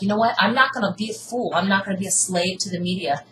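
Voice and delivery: ordinary delivery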